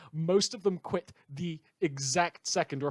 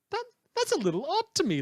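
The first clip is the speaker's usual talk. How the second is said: much higher, quick, put-on puzzled voice; mock bafflement; 4 dB louder, faster than usual. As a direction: feign innocent confusion, pitched way up. Mock bafflement at double standard.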